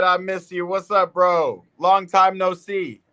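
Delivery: lightly voiced friend, high and playful; playful imitated friend; quoting a friend. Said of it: "he said".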